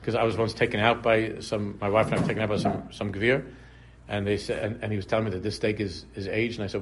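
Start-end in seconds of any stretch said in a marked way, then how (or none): none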